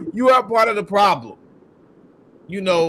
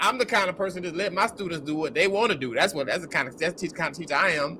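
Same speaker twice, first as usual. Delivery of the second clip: mocking the teacher; 3 semitones lower, 7 dB quieter, faster than usual. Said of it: "The way she talks".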